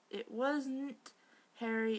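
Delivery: normal talking voice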